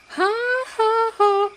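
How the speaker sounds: high, held-out mock laughter; imitated big laugh. Woman laughing down the phone, imitated high laugh. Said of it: "She loved to laugh".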